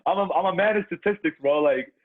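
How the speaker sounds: loud, high and fired up; all-in hype, shouting it out; hyped up. Real, not acted. Hyped over billion-dollar button hypothetical.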